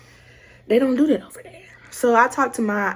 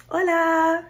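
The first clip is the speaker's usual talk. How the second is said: bright, high-pitched Spanish greeting; a light, upbeat hello to passersby; cheery hola; 8 semitones higher than usual. Retold or imitated. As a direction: sing out a friendly hola to a stranger. Greeting locals on the street.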